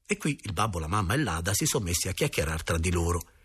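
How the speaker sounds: plain reading voice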